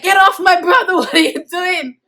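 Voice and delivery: high, loud urgent yell; retold protective shout. Brother to police beating his brother, high urgent shouting. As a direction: re-enact charging in to the rescue. Brother rushes in to save him.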